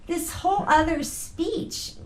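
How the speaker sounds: ordinary talk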